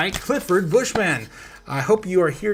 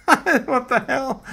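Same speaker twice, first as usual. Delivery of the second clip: laughing disbelief; 8 semitones higher, 4 dB louder, much slower than usual. Real, not acted. Baffled by weird chat message.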